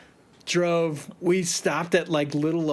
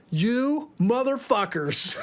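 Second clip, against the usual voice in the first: mock angry outburst; 8 semitones higher, slower than usual. Yelling at the band.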